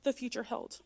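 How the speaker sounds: usual speaking voice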